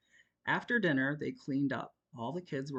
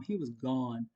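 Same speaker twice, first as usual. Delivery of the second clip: usual speaking voice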